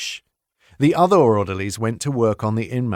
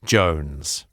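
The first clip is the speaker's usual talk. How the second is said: low menacing voice; 6 semitones lower than usual. A narrator's reading. Narrator voices menacing Jones.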